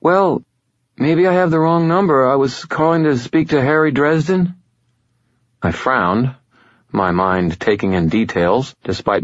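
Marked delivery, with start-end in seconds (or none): 0.0-4.6 high, tentative female caller; hesitant woman. A narrator's reading. Woman unsure she has the right number, hesitant high voice. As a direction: feel your way politely, pitched up. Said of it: "speech patterns had an odd hesitancy".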